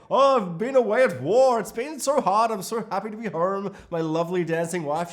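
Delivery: returning husband. Retold or imitated. Husband home from war greeting his dancing wife, hammed up and loud. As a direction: play the soldier home from war, gushing. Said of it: "He's like".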